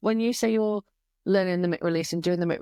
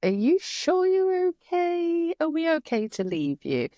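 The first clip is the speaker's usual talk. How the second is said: worried; 11 semitones higher, slower than usual. Retold or imitated. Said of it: "I was like".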